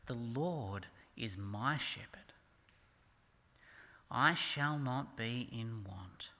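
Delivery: low, slow, measured psalm reading; scripture reading. Acted. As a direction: read the verse slowly and low, letting each phrase settle. Reads the psalm aloud.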